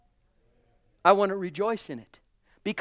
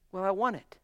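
ordinary talk

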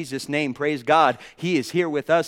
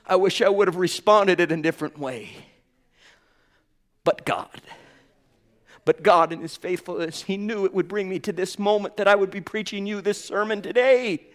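usual speaking voice

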